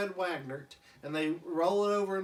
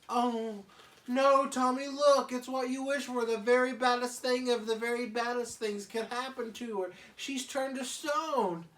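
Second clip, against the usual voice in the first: put-on higher, louder, fretful baby voice; Chucky the baby; 7 semitones higher, 4 dB louder than usual. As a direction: whimper it like a scared toddler. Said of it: "And Chucky goes".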